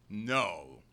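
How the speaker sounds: ordinary delivery, nothing marked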